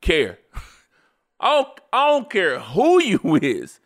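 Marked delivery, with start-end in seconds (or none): 1.4-3.8 higher, punched delivery with big swoops; hammed-up indignant insistence; emphatic slang declaration. Shown as a demonstration. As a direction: put your foot down, high and forceful. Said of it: "I'ma say it like that".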